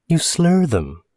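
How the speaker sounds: put-on high-pitched girl's voice; teasing young woman. Girl telling stranger about his odd speech, teasing. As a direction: lift it high, lightly teasing.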